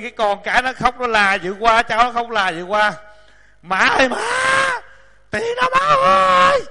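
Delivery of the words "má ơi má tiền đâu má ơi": put-on whining child calling. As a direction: stretch the last word into a high wail. Grandkids wailing for attention during practice.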